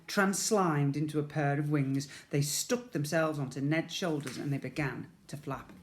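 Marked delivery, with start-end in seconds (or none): none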